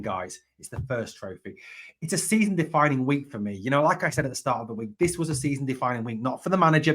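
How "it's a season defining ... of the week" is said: ordinary talk, nothing marked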